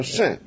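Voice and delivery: 肾 for 神, with a falling tone; Henan pronunciation. Shown as a demonstration. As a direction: say 肾 as the Henan way of saying 神. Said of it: "河南人讲什么神是说肾".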